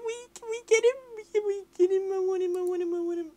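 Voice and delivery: high, whining plea for the transfer; whiny begging. Real, not acted.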